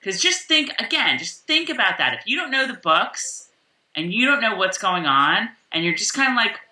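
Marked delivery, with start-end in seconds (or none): none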